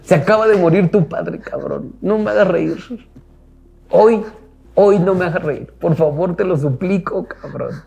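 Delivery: mock laughing mother, pleading "no me hagas reír"; mother laughing, begging. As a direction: beg him through laughter. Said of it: "se caga en la risa y me dice".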